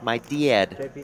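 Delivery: "dad" with a Minnesota vowel, like dee-ad; Minnesota accent. Retold or imitated. Teasing a friend over her word, Minnesota accent. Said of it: "what kind of Minnesota accent that is".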